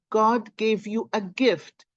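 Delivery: ordinary talk, nothing marked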